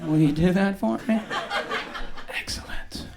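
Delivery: ordinary delivery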